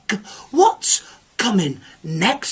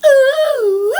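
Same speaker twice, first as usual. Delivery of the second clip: ghostly moan; 14 semitones higher, 5 dB louder than usual. Acted. Spooky story moan.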